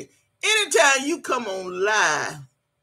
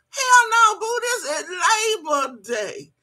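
much higher, laughing through the words; laughing outburst; 8 semitones higher, slower than usual. Real, not acted. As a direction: crack up while calling her out. Mocking confused commenter, laughing.

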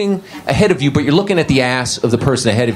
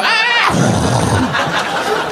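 much higher mock shriek. Comic arguing with the lady over shoes, mock shriek. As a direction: let out a sudden shriek. Shoe argument outburst.

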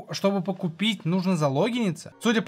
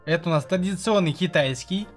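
ordinary talk, nothing marked

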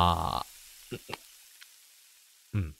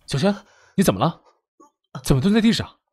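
loud, raised voice of worry; alarmed concern; 4 semitones higher, 7 dB louder than usual. Acted. Finding Xiaoxuan crouched on the floor, alarmed concern. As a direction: call out in alarm to a friend in trouble.

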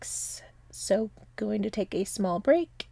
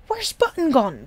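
a touch of startled surprise, raised; a puzzled old uncle asking, mildly startled; 6 semitones higher, 4 dB louder than usual. A narrator's reading. Uncle Noggin noticing Button vanished, mild surprise. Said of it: "said Uncle Noggin in surprise".

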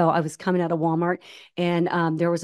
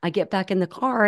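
ordinary talk, nothing marked

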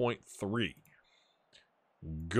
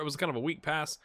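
normal talking voice

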